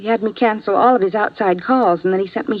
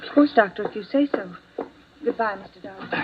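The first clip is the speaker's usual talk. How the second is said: fading softer and softer as she gives in; much softer, a meek, obedient farewell; soft reluctant goodbye; 6 dB quieter than usual. Acted. Nurse dismissed by doctor, leaving.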